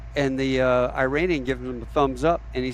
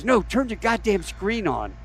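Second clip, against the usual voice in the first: retold exasperated order; 7 semitones higher than usual. Gunny snaps order at navigator.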